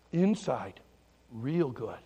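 gentle contrast. Acted. Pastor teaching kids the lesson, low and gentle. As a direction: let it settle down low and tender. Contrasts inside with outside for children.

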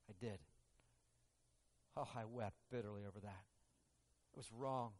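soft, low remorseful admission; quiet confession. Acted. Peter admitting his denial, soft and remorseful.

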